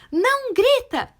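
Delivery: high, loud, drawn-out mock yell; a parent's acted shout, high and slow. Parent telling a child not to shout, shouting.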